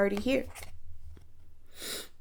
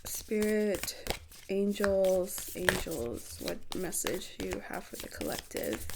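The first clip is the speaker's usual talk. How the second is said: quiet invocation; 10 dB quieter, slower than usual. Real, not acted. Calling on angels for message.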